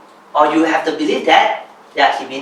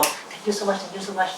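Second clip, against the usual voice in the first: soft, humble repeated thanks; imitated humble gratitude; 2 semitones higher, 10 dB quieter than usual. Mentee showing past posture to mentor, softly grateful. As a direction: re-enact a deferential thank-you, much softer. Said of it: "That was my posture".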